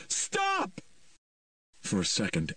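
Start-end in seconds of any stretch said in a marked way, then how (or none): 0.1-0.8 a high, sharp cry; woman yelling from across the clearing. A narrator's reading. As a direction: yell to freeze everyone.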